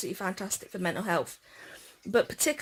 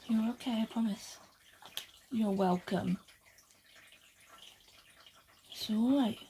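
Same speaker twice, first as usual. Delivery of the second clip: gentle, hushed comfort; soothing; 6 dB quieter than usual. Real, not acted. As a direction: promise the frightened animal it's safe. Comforting a scared pet.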